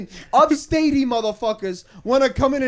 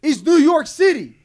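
slow, loud, higher-pitched declaration; emphatic insistence; 4 semitones higher, 9 dB louder, slower than usual. Real, not acted. New Yorker telling friends only the city counts, emphatic. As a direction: hammer each word home, exasperated. Heated argument, laying down definition.

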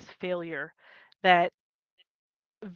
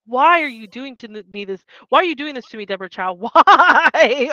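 loud, hammed-up wail of betrayal; a half-laughing why me plea; mock anguish; 2 semitones higher, 9 dB louder than usual. Fan wails at the showrunner over a sad scene, hammed-up and laughing.

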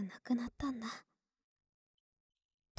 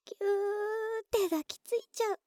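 playful cute; 9 semitones higher, 3 dB louder than usual. Acted. Playfully hugging the listener.

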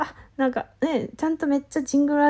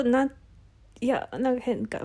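ordinary talk

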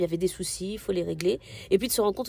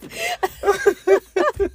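squealing burst of laughter; loud high laugh; 13 semitones higher, 10 dB louder than usual. Real, not acted. Laughing at spider image.

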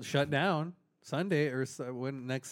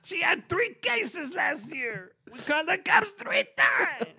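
high loud Italian mobster; a loud mob-guy complaint; Italian accent; 4 dB louder, slower than usual. Pretend. S1 continues the Italian bit.

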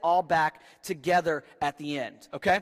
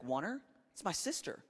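sly mock offer; 10 dB quieter than usual. Abram offering wife.

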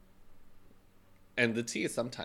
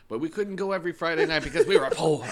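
put-on higher, louder laughing old-timer; 8 semitones higher, 6 dB louder than usual. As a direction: ham up a nostalgic grandpa. Mock old-timer reminiscing.

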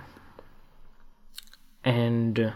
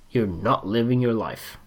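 quiet, grave statement; 4 semitones lower, 6 dB quieter than usual. Shown as a demonstration. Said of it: "this is gonna be very hard for you to hear".

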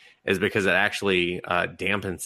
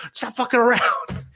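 high, put-on annoyed voice; squeaky retort; 3 dB louder than usual. Ghost dad telling guy to quit it, high irritated voice. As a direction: snap back in a squeaky voice.

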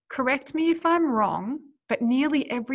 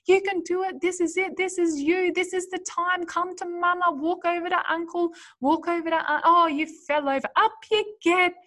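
high, bright encouraging cheer; cheering on a toddler; 7 semitones higher, 4 dB louder than usual. Retold or imitated. Parent cheering a toddler's first steps. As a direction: cheer the baby on to take a step. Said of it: "we clap our hands and we're like".

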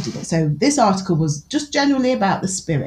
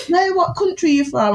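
high-pitched imitation of exasperated prodding; frustrated questioner; 10 semitones higher than usual. Frustrated customer pushing shop worker for her country, high insistent imitation. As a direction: press the question as if losing patience. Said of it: "I can see him getting frustrated".

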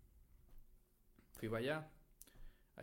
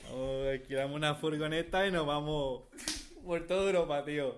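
put-on higher, louder Castilian Spain accent; Spanish accent; 4 semitones higher, 7 dB louder than usual. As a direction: sell the road trip in a Madrid accent. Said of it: "tú tienes varios acentos". (acento español de España)

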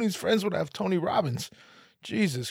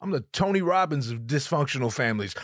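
ordinary talk

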